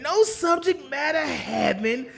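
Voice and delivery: ordinary delivery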